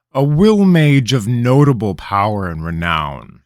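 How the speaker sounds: proud, loud royal address, slowing on the name; a king savouring his own authority; a lightly voiced haughty king; imperious king. King holding court.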